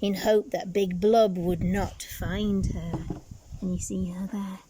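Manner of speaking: plain reading voice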